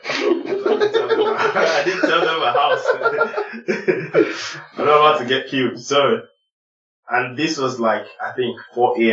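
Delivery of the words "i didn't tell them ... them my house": high, slow, laughing through words; real laughter breaking in. Real, not acted. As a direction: giggle while admitting it. Laughing retelling of distrust.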